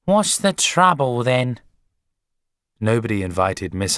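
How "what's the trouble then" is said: high, loud, hostile demand; glaring. A narrator's reading. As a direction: snappish wife confronting the visitor. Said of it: "glared at her and said".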